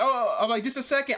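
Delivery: high, flustered and rushed; panicked. Retold or imitated. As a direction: caught off guard, yelping. Panicked, caught naked by nurses.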